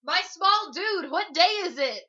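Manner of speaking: high, very loud dude-speak holler; giddy shout to a kid below, words stretched; yelling down. Acted. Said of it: "yells down at the first street urchin".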